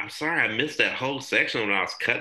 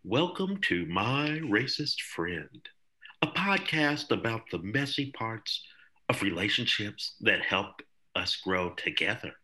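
soft, unhurried fancy announcer voice; 5 dB quieter than usual. Shown as a demonstration. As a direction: ease into a hushed, smooth-talker show intro.

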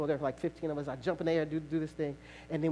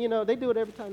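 usual speaking voice

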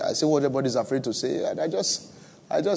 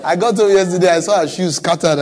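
laughing loudly; 5 semitones higher, 8 dB louder than usual. Real, not acted.

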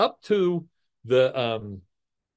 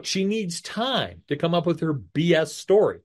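usual speaking voice

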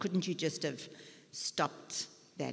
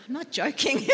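said through real laughter, higher and louder; laughing; 7 semitones higher, 9 dB louder than usual. Real, not acted. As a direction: laugh the words out. Laughing at her own joke.

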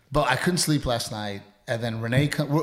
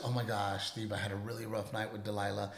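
much softer, lower weary confiding; gentle confiding; 5 semitones lower, 12 dB quieter than usual. Shown as a demonstration. Husband showing wife what to say in bed, softly confiding. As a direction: quietly share a rough night with a partner. Models how wife should have spoken.

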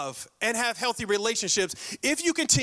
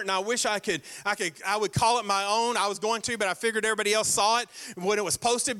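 usual speaking voice

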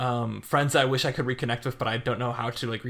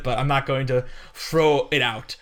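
ordinary talk

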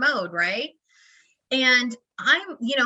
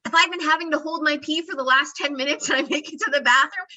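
usual speaking voice

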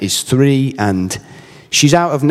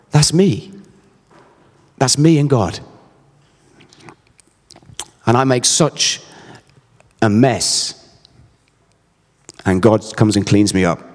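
choked-up, halting delivery with long pauses; getting emotional; 2 semitones lower than usual. Real, not acted. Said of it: "I don't know why I'm getting emotional".

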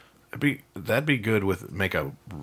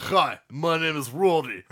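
put-on slow, higher Russian-accented introduction; Rody impression; 6 semitones higher, slower than usual. Co-host shows [name]'s impression of Rody, hammed-up Russian accent. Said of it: "That's [name]'s impression of".

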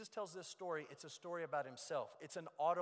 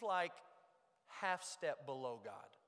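normal talking voice

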